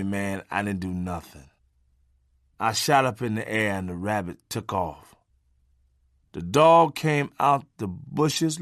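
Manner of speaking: normal talking voice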